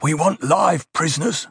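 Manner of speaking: high hissed command; hissed order. A narrator's reading. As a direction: hiss it like a guard officer. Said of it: "one of the guard officers hissed".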